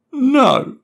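put-on high, loud child's shout. A narrator's reading. Boy in the tree shouting down to his dad. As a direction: shout back defiantly from the tree. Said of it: "Matty shouted back".

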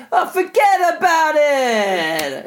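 high, drawn-out mobster shout; Sopranos mobster accent. Retold or imitated. Cupid brushes off Miley's favour, hammed-up mobster accent. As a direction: bellow it like a cartoon New York gangster. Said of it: "one of the actors from The Sopranos".

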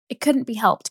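Daisy covering the awkward call with forced brightness; tense gaiety. A narrator's reading. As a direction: force cheer over the tension. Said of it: "cried Daisy with tense gaiety".

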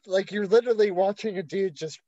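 ordinary delivery

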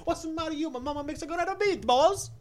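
high, loud mock Brooklyn Italian; hammed-up Brooklyn tough guy; Brooklyn accent. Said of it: "the Brooklyn guy".

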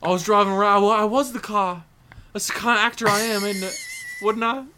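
high, loud mock-Cockney; Michael Caine. Playing the actor bragging about a cartoon role, high mock-Cockney. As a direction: boast in a hammed-up English actor voice. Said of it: "we're just talking about Michael Caine now".

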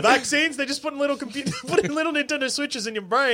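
high, laughing conspiracy rant; conspiracy theorist. Pretend. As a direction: play a paranoid crank, giggling. Mock conspiracy banter.

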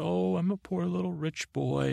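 singsong mockery of a poor little rich boy; mocking whine. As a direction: tease him like a pouty rich kid. Shae mocking Tyrion's complaint.

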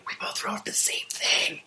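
whispered mock discovery; hushed retold remark. Two people noticing they wrote alike, hushed whisper. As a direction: whisper it as a quoted aside.

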